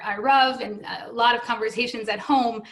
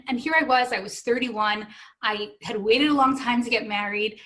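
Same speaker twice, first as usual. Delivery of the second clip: ordinary delivery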